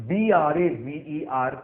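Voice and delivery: slow, letter by letter; spelling out letters. Shown as a demonstration. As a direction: spell each letter out clearly. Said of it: "जब हम comparative करेंगे".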